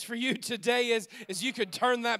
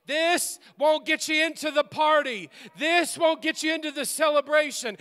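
preaching at full force; 5 semitones higher than usual. Acted. Pastor preaching hard to the congregation, full force. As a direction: preach it out with rising fire.

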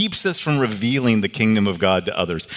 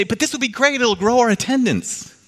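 much higher, bright mock enthusiasm for growth; mock eager church leader; 11 semitones higher than usual. Pastor mocking his own church's self-interest, in a high eager mock voice. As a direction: parody the self-serving excitement, pitched high. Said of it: "but then we say".

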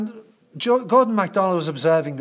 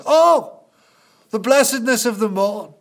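exclaiming; 5 semitones higher, 5 dB louder than usual. Retold or imitated. Jesus proclaims to the crowd.

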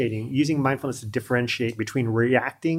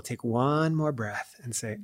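ordinary delivery

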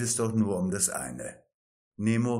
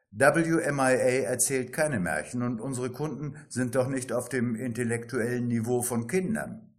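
ordinary talk, nothing marked

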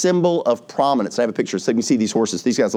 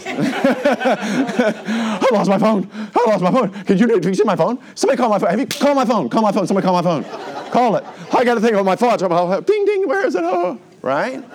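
mock phone panic; 10 semitones higher than usual. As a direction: play someone frantically hunting their phone. Acting panic over lost phone.

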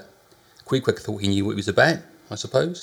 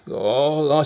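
chambermaid crying out; 5 semitones higher, 4 dB louder than usual. A narrator's reading. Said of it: "she cried".

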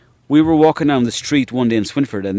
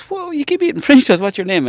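mock girl's voice, pitched up high; imitating the girl; 13 semitones higher than usual. Girl asks French Toast his name, pitched-up mock girl voice.

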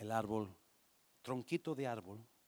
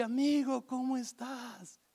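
higher, bright-eyed greeting from the tree; happy tree voice; 8 semitones higher, 3 dB louder than usual. Acted. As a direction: welcome the friend back. El árbol saluda otra vez. (el árbol feliz, voz más aguda)

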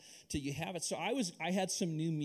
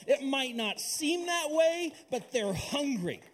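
slow, louder preacher's build over music; preaching at full force; 6 semitones higher, 6 dB louder than usual. Acted. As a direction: pastor urging the church the world is hungry, slow and loud.

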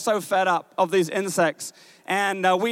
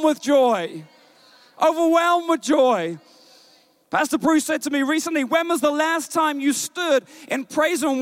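higher-pitched, urgent exhortation; impassioned preaching; 4 semitones higher than usual. Acted. Preacher urges congregation to be overwhelmed with joy, impassioned preaching. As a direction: drive the challenge home to the congregation.

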